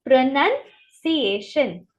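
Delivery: प्रोनंसिएशन drawn out, O silent; slow model pronunciation. Shown as a demonstration. Teacher repeats the correct word slowly. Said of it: "हम इसको कैसे बोलते हैं".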